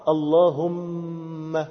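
اللهم drawn out on a hummed, closed-lip m; lips closed on mim. Shown as a demonstration. Teacher explaining the mim's articulation, اللهم with a lingering m. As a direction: hold the mim of اللهم long, then open the lips. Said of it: "ضم كده".

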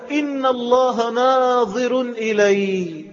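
very slow, high, drawn-out declaration; ringing declaration. Acted. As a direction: stretch every word out high and ringing. Voices the inner conviction emphatically.

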